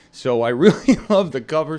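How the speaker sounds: a laugh bursting into the thanks. Real, not acted. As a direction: laugh while gushing over the cover art. Thanking volunteer for cover art.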